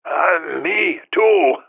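high croaky voice broken by throat-clearing; hacking cough voice. A narrator's reading. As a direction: hack and clear the throat through it. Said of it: "phlegmy coughing fit".

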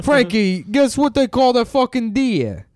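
lively Italian guy amazed at the deer; mock Italian. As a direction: ham up an Italian tourist. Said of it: "they're like".

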